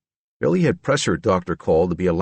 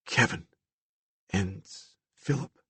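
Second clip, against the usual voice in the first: hushed; 8 dB quieter than usual. A narrator's reading. Billy names the voices quietly.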